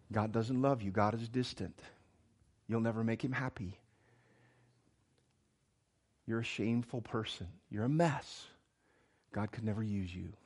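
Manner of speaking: hushed, condemning inner parent. Retold or imitated. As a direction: murmur the accusations like a harsh parent in your head. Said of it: "condemning tones".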